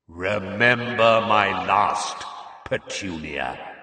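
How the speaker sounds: slow, drawn-out booming voice, loud then dropping; awful echoing voice. A narrator's reading. Burning letter to the aunt, awful echoing voice. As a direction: boom it out slowly, echoing. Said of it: "An awful voice filled the kitchen".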